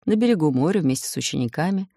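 ordinary delivery, nothing marked

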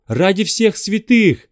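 slow loud high plea; pleading shout. Acted. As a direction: beg at the top of your voice. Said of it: "взмолился он".

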